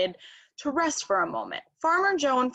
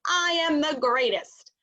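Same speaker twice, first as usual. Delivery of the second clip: boastful shout; 6 semitones higher, 3 dB louder than usual. A narrator's reading. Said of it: "Wing shouted".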